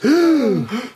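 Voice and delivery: sharp gasp. Acted. Sees Frau Sparbrot coming.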